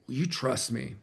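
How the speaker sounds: softly spoken, weight on "trust"; quiet ask, "trust" given the emphasis; stress on "trust". Shown as a demonstration. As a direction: lean on "trust" in a hushed closing question. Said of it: "really stress that word".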